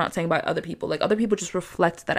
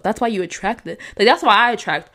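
normal talking voice

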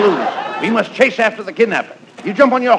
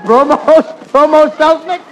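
mock surprise; 7 semitones higher, 3 dB louder than usual.

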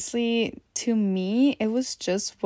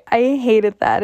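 ordinary delivery

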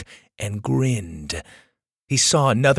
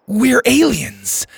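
high, loud, drawn-out blurt; 11 semitones higher, 5 dB louder, slower than usual. A narrator's reading. Lainey to the driver who caught them, blurting desperately. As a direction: burst out with it, pitch leaping. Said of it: "Lainey blurted".

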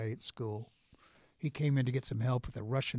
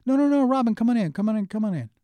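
much higher and louder, bubbling over; eager welcome; 10 semitones higher, 8 dB louder, faster than usual. Retold or imitated. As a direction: gush like a thrilled teacher. Teacher welcomes [name] in.

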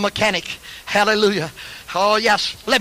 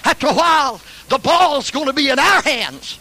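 rally the church, climbing higher and higher; preaching crescendo; 9 semitones higher than usual. Real, not acted. Preaching builds to a shout.

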